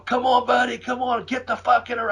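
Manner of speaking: high mock yell to his partner; retold yelling. Urging Buddy into the ring, high mock yell. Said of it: "I started yelling".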